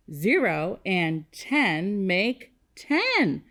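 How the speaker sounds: slow, word-by-word recital; leading choral repetition. Shown as a demonstration. Teacher leading kindergartners to say it with her, slow choral pace. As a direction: space out each word so all can join. Leads class in saying number sentence.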